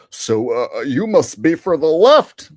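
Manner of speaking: loud, rising mock accusation; mocking imitation of a critic. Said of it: "somebody always is like".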